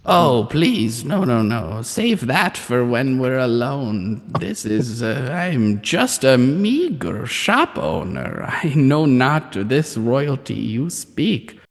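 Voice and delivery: put-on creaky old crone, slowly; old hag. Shopkeeper crone keeps her voice.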